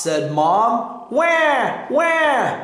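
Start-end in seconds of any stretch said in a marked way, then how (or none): 1.1-2.6 high, drawn-out imitated wail; baby seal cry. A narrator's reading. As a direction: call out "wah" like a baby seal. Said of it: "cried the baby seal".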